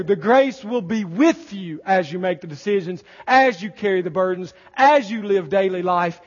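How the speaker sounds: loud, high, rising preacher's cry; full-force pulpit exclamation; fervent preaching. Acted. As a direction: preach it with mounting awe.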